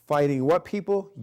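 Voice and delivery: loud rising call to the class; an instructor quizzing his class with a loud call. Shown as a demonstration. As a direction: bark it out to the students.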